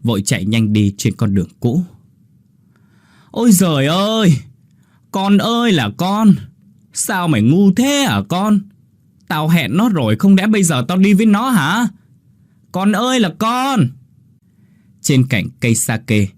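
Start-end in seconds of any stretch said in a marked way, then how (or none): 3.3-8.6 wailing lament. Acted. Mother wails after Linh runs off. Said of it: "đang đứng đó mà gào thét". 9.3-11.9 the real mother's cry; wailing lament. Acted. Mother wails after Linh runs off. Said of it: "gào thét". 12.7-13.9 wailing lament. Acted. Mother wails after Linh runs off. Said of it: "đang đứng đó mà gào thét".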